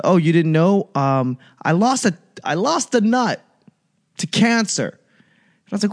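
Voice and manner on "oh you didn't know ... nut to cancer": lightly squeaky high buddy voice; buddy reveals surgery, casual high voice; buddy's high voice. Retold or imitated. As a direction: play the buddy breezily high.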